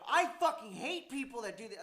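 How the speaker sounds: loud, heated outburst; ramping up angry. Real, not acted. Ranting to a friend about manipulative exes, loud and angry. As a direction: let the anger boil over. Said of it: "I started ramping up".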